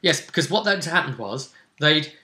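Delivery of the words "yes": ordinary talk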